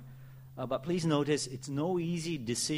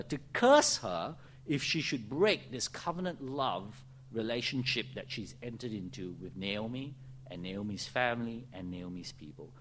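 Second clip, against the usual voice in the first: ordinary talk, nothing marked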